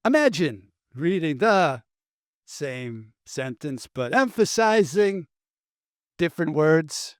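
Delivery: halting celebrity impression, odd pauses and sudden lifts; oddly paced, lurching mimicry with a hushed middle; Christopher Walken. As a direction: break the sentence in strange places, dragging some words and jumping on others. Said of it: "This is the Christopher Walken read".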